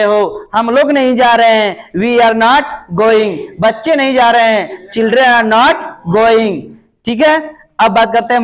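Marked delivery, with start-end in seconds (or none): 2.0-3.4 each word slow and spaced; teacher spelling out a sentence; slow dictation. Shown as a demonstration. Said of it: "slow dictation of English". 4.9-6.6 each word slow and spaced; teacher spelling out a sentence; slow dictation. Shown as a demonstration. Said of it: "slow dictation of English".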